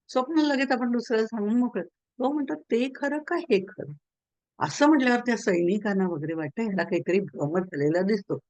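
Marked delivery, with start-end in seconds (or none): 2.6-3.9 slow, higher-pitched riddle; pondering question. Retold or imitated. King answering everyone with his one question, pondering. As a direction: ask it slowly, as if puzzling it out.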